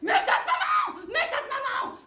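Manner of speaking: put-on very high loud child's wail, slow; girl's shrieking cry. Little girl screaming at her mother, shrill wail. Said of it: "crie la petite fille".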